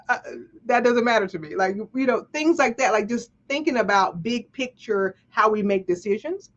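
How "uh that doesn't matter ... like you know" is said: ordinary delivery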